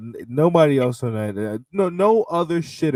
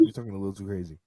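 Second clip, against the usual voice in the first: soft repeat; 2 semitones lower, 11 dB quieter, much faster than usual. Real, not acted. Repeat, much softer.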